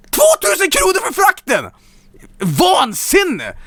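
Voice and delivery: high outraged yell; outraged shout. Real, not acted. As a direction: explode at the price. Outraged at the shipping cost.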